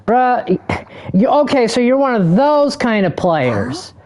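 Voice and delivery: can't believe this guy; exasperated. Real, not acted. Streamer ranting at a teammate, incredulous and high.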